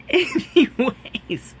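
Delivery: high, slow word broken by laughter; laughing. Real, not acted. Vlogger trying to move on from innuendo, laughing. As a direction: laugh right through the word. Moving on while still laughing.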